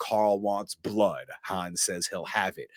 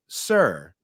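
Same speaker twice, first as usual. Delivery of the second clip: usual speaking voice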